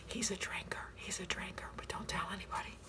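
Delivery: hushed gossiping whisper; conspiratorial whisper. Real, not acted. Tells viewers a secret about her friend, whispering.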